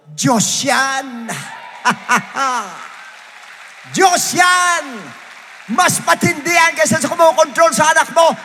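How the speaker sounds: loud, high shouted preaching; preaching at full force. Acted. Preacher declares God is stronger, in a full-force shout. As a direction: shout it out to the whole church.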